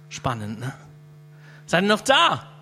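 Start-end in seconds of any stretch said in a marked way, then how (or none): 1.7-2.5 much louder check on the room; loud call-out. Real, not acted. Preacher calls out to a drowsy congregation, loud. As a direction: wake them up with a call. Rousing the audience.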